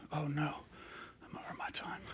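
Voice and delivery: startled whispered aside. Real, not acted. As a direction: whisper it to yourself, caught out. Realizes she ran over time.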